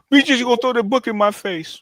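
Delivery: high-pitched mimicking voice; mock indignation. Man mimicking someone told to read Schwab, high mock indignation. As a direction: put on a squeaky, affronted objector.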